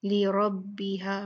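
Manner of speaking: लिरब्बिहा, slow, रा kept thick and full; heavy ra. Shown as a demonstration. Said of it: "ये मोटी पड़ी जाएगी".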